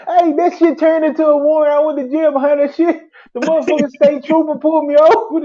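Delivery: amused and laughing; laughing disbelief. Real, not acted. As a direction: tell it while still cracking up. Still laughing telling it.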